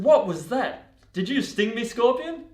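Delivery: high, loud, indignant disbelief; shocked outcry. Acted. Frog just stung in the back confronting the scorpion, loud shocked question. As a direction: cry out in startled accusation.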